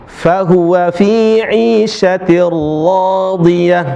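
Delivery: measured melodic tilawah; Quran recitation. Shown as a demonstration. Teacher recites Quran verse. (tilawah Al-Quran)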